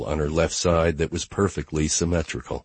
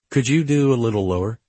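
female character voice; 6 semitones higher than usual. A narrator's reading. Gia voiced, flirty.

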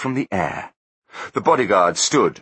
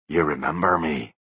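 low ghostly voice; 3 semitones lower than usual. A narrator's reading. Ghostly Butler voice.

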